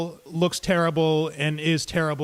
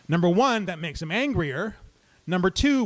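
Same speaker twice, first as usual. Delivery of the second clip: ordinary talk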